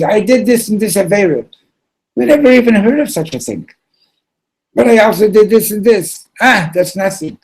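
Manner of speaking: high, agitated acted outpouring; frantic confession. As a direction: blurt it out in a hammed-up panic. Desperate confession of sins acted out.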